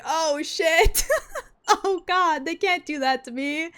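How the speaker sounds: high flustered cry, half laughing; flustered squeal. Retold or imitated. Streamer retelling her reaction to the villain, squealing. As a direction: squeal like you just got flirted with. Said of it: "I was like".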